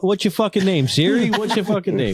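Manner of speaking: high mock yell at the robot; mock angry yell. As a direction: bark the question at the machine in fake outrage. Mocking yelling at drive-through AI.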